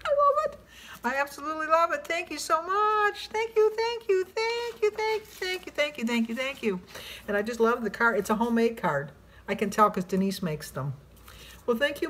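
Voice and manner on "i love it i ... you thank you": high, giddy run of thanks; gushing thanks. Real, not acted. Thanks the sender over and over, giddy and high. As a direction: gush your thanks over and over.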